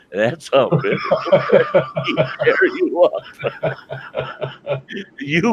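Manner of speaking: words breaking into laughter, pitch jumping up; can barely get it out for laughing. Real, not acted. Laughing through the cat story.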